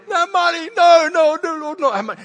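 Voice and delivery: high, whiny mock protest about money; mock whining. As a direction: whine like a stingy congregation. Mock reluctant church members.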